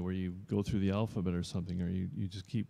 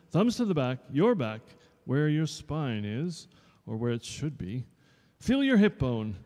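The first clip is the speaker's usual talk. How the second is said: higher and louder, drilling posture; strict dance teacher; 5 semitones higher, 6 dB louder than usual. Acted. Dance teacher drilling pupils on posture, stern. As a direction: bark the drill at the class.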